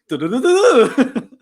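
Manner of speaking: high, loud laughing nonsense syllables; laughing babble. Retold or imitated. Friends joking about a teammate's English, laughing babble. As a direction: mimic the gibberish through laughter. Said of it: "laughing mimic noise".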